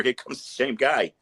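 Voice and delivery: ordinary talk, nothing marked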